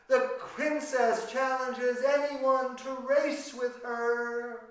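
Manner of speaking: loud, slow town crier's call; crier shouting. Acted. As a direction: proclaim it like a royal crier to the crowd. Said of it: "the king's crier was shouting out".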